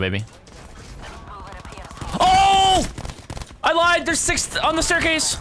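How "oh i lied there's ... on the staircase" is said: panicked yelling. Real, not acted. Panicked gaming screams.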